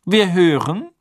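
strenger Beamter, hoch und laut; kühl fordernd verhören; Crouch. Acted. (Crouch fordert Karkaroff streng zum Reden auf)

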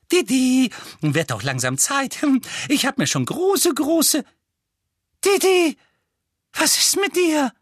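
high, shrill-pitched anxious mother; fussing high mother voice; Aunt Petunia voice. A narrator's reading.

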